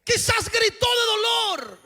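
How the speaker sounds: climbing sermon shout; fiery preaching. Acted. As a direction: preach it out to the whole church at full force. Preacher rises to full force.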